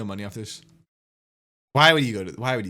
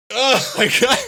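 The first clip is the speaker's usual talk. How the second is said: exasperated outburst; 11 semitones higher, 8 dB louder than usual. Real, not acted. Exasperated outburst during rant.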